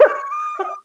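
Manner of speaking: squealing laugh bursts out; a high delighted squeal of a laugh; high burst of laughter. Real, not acted. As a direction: shriek with laughter. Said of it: "I laughed".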